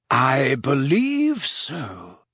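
put-on slow, weighty old headmaster's reply; grave old wizard. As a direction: let the answer land slowly and gravely. Dumbledore's grave slow answer.

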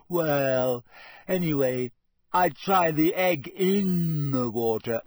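put-on slow, drawn-out glum ghost girl.

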